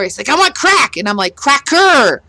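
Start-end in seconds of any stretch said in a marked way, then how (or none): 0.3-1.0 put-on high, loud kid's demand; imitated child's yell. Parent imitating the child yelling at the store, loud. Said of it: "he yelled at the store". 1.4-2.2 high, loud re-enacted correction; exasperated correction. Parent correcting the child's word in the store, exasperated.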